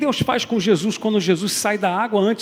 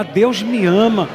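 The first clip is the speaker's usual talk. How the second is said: louder, slow, forceful declaration; 4 semitones higher, 8 dB louder, much slower than usual. Shown as a demonstration. Congregation told to say it with force. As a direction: proclaim it with conviction. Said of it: "Gente, fala com força isso".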